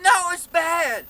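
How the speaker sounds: high, slow, drawn-out heckler's yell; imitated yelling.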